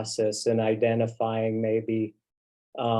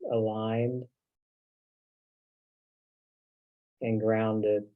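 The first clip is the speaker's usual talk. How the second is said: spoken prayer. Real, not acted. Minister prays for the group to be centered and grounded, hushed prayer. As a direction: pray calmly, letting each phrase rest before the next.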